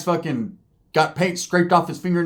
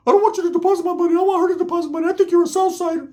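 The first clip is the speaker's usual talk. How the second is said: very high, squeaky mock voice; high cartoon voice imitation; Ricky's voice; 12 semitones higher, much faster than usual. Ricky at bank.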